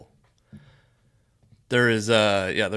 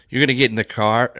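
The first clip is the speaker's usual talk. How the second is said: put-on Wisconsin accent; 6 dB louder than usual. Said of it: "I'm turning into, like, Lois Griffin".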